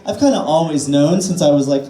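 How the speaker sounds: usual speaking voice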